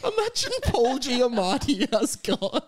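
high, slow, laughing delivery; tickled by an absurd idea; laughing through words. Real, not acted. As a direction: barely get it out for laughing.

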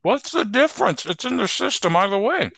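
talking with food in mouth; words round a mouthful; mouth full. Real, not acted. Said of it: "finish what's in your mouth before you speak".

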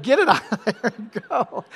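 yelling with a laugh. Retold or imitated. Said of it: "always yelling".

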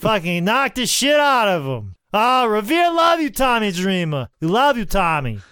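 a hammed-up, rowdy Revere local in a Boston accent; shouting Boston fan hyping Sandman and Tommy. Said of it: "Boston accent".

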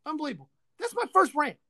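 usual speaking voice

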